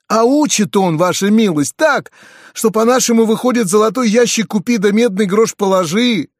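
high, lively peasant talker; talkative peasant. A narrator's reading. Peasant explains the lessons to the gentleman, talkative.

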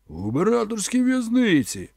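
high exclamation; exclaiming. A narrator's reading. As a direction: fling the answer up at them. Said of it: "вигукнув Конан".

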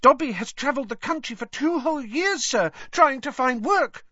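very high squeaky elf voice; Dobby squeaking. A narrator's reading. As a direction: squeak it like an earnest house elf. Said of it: "Dobby squeaked".

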